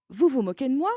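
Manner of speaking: loud, indignant question; angry. Acted. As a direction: accuse him, you feel mocked. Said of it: "Jack est en colère".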